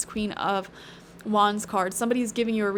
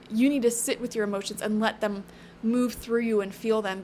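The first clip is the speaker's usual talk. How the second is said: usual speaking voice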